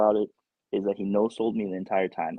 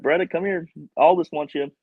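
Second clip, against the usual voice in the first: higher, louder call across the room; 6 semitones higher, 4 dB louder than usual. Retold or imitated. Crew calls Beretta to the doorway, a mock call out. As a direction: call him over from the doorway. Crew calling him over.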